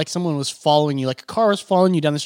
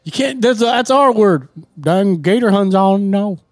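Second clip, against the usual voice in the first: slow, loud Cajun drawl; hammed-up Cajun accent, slow; 3 semitones higher, 5 dB louder, slower than usual. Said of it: "Are they Boomhauer now?".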